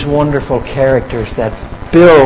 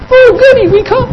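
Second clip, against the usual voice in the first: much higher sing-song mock cheer; mock delighted kids. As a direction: squeal it like giddy boys, dripping sarcasm. Mocking the boys' false joy.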